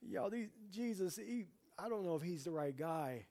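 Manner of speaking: a hint of an unsure follower; doubting disciple. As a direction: voice a hesitant disciple thinking aloud. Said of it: "these disciples are probably thinking".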